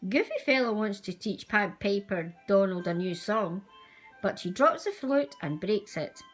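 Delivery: plain reading voice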